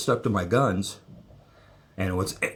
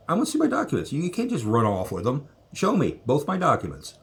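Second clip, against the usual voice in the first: higher-pitched, insistent protest; retold insistent demand; 6 semitones higher than usual. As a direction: retell demanding your papers back. Retold demand to airport staff.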